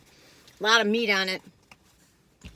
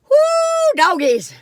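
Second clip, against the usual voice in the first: high whooping exclamation; excited whoop; 9 dB louder, much slower than usual. Real, not acted. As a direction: let out a big whoop. Exclaims after bear story.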